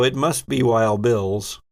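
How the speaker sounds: ordinary talk, nothing marked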